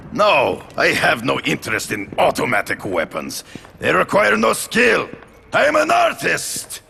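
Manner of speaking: angry at the caller, ending in a high indignant shout; rising outrage. Acted. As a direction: build from a sharp no to a furious, offended cry. Angry phone call about weapons.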